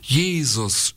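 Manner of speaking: crying out. A narrator's reading. Blind Bartimäus to Jesus, crying out. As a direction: call out high, without real shouting. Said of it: "schrie Bartimäus".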